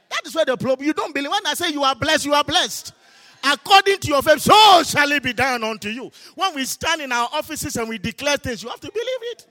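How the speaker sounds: loud, high, forceful preaching; preaching at full force. Acted. Pastor urging the congregation to believe, full-force preaching. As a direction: proclaim it to the whole church. Preacher declaring faith loudly.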